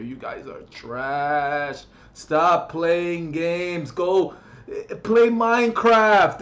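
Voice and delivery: slow, drawn-out taunting; contemptuous scolding. Real, not acted. Gamer telling bad players to quit, slow and contemptuous. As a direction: spell it out slowly to the noobs.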